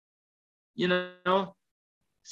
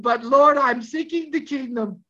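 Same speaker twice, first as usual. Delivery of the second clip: protest; 6 semitones higher, 3 dB louder than usual. Retold or imitated. Retold protest to the Lord.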